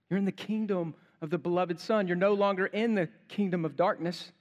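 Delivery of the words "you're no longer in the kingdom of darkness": ordinary talk, nothing marked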